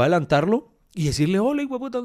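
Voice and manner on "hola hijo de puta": much higher, half-shouted insult; mock yell. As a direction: retell yelling at the trucker, pitch up, no extra volume. Insulta al camionero que los arrastra. (gritándole al camionero, en broma y agudo)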